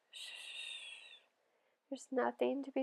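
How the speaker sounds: barely audible hush; very quiet. Acted. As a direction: hush him gently, barely there. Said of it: "so quietly I could barely hear her".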